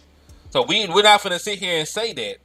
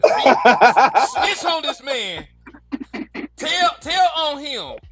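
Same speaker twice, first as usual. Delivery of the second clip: laughing quote; 7 semitones higher, slower than usual. Retold or imitated. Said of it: "He said".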